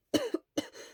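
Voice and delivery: dry cough; sick cough. Acted. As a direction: cough into your hands. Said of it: "coughed into his hands".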